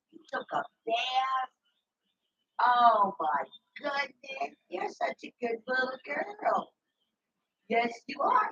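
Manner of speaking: high, slow doting coo. Real, not acted. Doting on the little girl, slow high baby talk.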